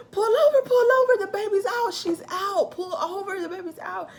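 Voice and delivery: high, loud frantic yelling; panicked screaming. Retold or imitated. Woman in labor yelling at husband to pull over, frantic. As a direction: shriek it at him in a panic. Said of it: "I'm like".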